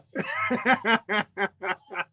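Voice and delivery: long rolling laugh; real laugh. Real, not acted. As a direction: crack up laughing at your own tease. Laughs at own teasing of Sherry.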